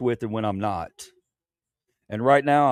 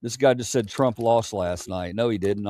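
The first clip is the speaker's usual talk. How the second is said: usual speaking voice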